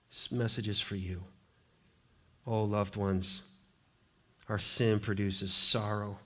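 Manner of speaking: hushed, low and soft; tender appeal. Real, not acted. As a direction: drop to a gentle, low pastoral voice. Preacher turns tenderly to listeners.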